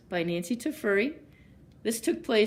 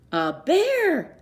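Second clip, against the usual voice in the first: high, louder frightened scream; 9 semitones higher, 6 dB louder than usual. A narrator's reading. Eddie meeting the giant bear, a high frightened scream. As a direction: shriek at the sight of a bear. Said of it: "screamed Eddie".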